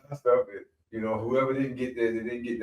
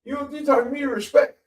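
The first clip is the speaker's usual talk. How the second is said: much higher demanding respect; defiant kid; 13 semitones higher than usual. Retold or imitated. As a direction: talk back hard. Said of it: "And Ricky was like".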